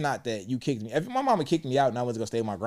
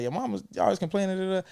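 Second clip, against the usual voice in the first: lightly voiced father, higher and quick; imitating his father; 8 semitones higher, faster than usual. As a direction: quickly mimic dad passing on complaints. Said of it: "He was like".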